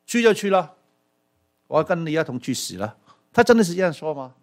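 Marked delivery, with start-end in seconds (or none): 0.1-3.0 Thomas answering. Retold or imitated. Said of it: "他怎么说？".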